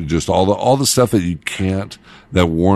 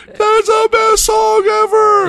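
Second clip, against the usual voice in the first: high, loud, slow gushing rave; mock the fans' over-the-top excitement; 6 dB louder, slower than usual. Fans gushing to the DJ about the song, high and loud.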